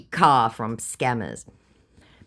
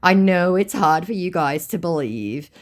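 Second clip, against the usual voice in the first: mock-sympathetic, raised pitch and louder; sarcastic; 5 semitones higher, 5 dB louder than usual. Real, not acted. Teasing American viewers about their country, sarcastic. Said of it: "I am being sarcastic".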